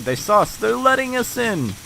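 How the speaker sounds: rising, thrilled exclamation; happy voice climbing high; excited. Real, not acted. Gate opens.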